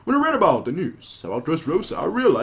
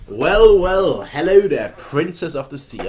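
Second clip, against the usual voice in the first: higher and louder, slow charming nobleman; smooth noble flirt; 4 semitones higher, 9 dB louder than usual. Acted. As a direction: greet the princess like a smarmy lord. Reading noble's flirty greeting.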